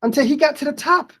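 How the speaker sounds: lively storybook voice with big rises; reading to children. Acted. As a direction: read it like a picture book to kids. Teacher reads picture book aloud.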